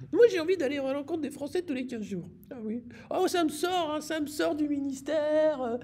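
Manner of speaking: very high, airy minister voice; high mocking voice. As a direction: squeak it like a giddy minister.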